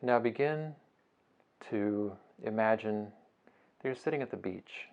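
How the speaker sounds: soft, unhurried meditation voice with long pauses; guided meditation. Acted. Teacher guiding listeners through a beach breathing meditation, soft and unhurried. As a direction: ease them gently through each breath, phrase by phrase.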